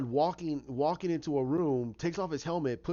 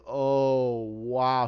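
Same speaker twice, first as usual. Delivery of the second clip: loud, drawn-out awe; mock amazement; 2 semitones lower, 6 dB louder than usual. Viewer reacting to the laugh, loud mock amazement. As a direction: stretch it out as a stunned viewer. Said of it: "and you're like".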